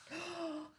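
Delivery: quick, high delighted gasp; excited gasp. Real, not acted. Finding a glossary in the book, delighted gasp. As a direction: gasp at a happy discovery. Discovering another glossary page.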